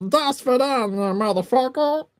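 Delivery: high cartoon voice with foreign words; Meatwad. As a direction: squeal mock-foreign words as a cartoon creature. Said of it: "foreign words for meat wad".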